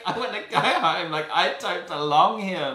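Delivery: tearful wail. Retold or imitated. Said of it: "started crying".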